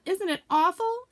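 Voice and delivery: very high, slow dread; terrified Piglet. Acted. Piglet clutching Christopher Robin's hand. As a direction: quake over it.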